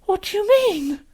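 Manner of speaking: put-on high, loud, frightened girl; alarmed cry. Lucy realising the faun's meaning, crying out in alarm. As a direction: cry it out, voice leaping up.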